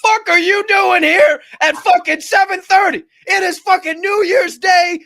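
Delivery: high, loud retold furious shouting; re-enacted screaming rant; mad as a hornet. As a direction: bellow it like an enraged, rudely woken host. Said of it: "madder than a motherfucking hornet".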